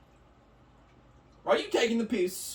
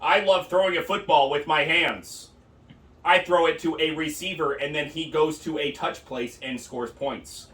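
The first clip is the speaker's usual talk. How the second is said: mock American accent, a touch lower; American impression; 7 semitones lower than usual. Comedian mocking Americans, mock American accent. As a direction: play a dumb American bloke. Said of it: "my impression of an American".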